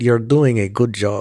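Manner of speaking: mildly imitated Russian-accented English. Shown as a demonstration. Host proving to co-host it was Putin, light Russian accent. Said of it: "Why did you give him a West African accent?".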